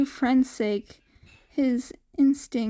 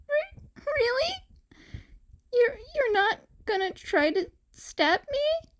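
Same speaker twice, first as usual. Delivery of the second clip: put-on high, faltering young boy voice, stammering; timid cat boy; 13 semitones higher than usual. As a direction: ask it in hopeful disbelief. Disbelieving, hopeful cat boy.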